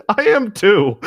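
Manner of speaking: said through a laugh. Real, not acted. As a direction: laugh it out while agreeing. Laughs while admitting sadness.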